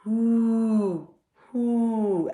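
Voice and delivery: high, drawn-out hooting; owl hoot. A narrator's reading. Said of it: "asked the owl".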